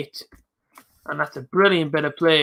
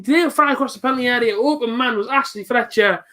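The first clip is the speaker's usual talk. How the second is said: raised, lively commentator read; play-by-play commentary; 6 semitones higher than usual. Acted. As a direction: talk the replay through like a pundit. Replays the goal as commentator.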